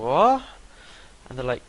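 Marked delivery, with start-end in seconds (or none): 0.0-0.4 dazed what. Retold or imitated. Waking up on the floor.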